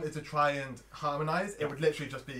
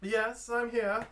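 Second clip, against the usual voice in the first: higher, louder slow answer from behind a door; female voice; 8 semitones higher, 5 dB louder, much slower than usual. Acted. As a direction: answer as an unseen woman. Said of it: "It's a female voice".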